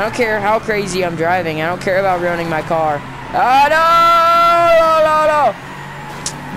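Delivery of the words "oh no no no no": dismayed cry. Real, not acted. Losing control in racing game.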